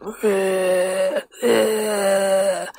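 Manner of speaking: low, guttural zombie moaning ugh; zombie grunt. Retold or imitated. Advice host imitating a zombie's moan, a low guttural grunt. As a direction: groan like a shambling zombie. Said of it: "grunt and moan like".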